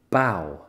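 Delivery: bow said as English "bau". Shown as a demonstration. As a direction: model bow for learners. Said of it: "on écoute [name]".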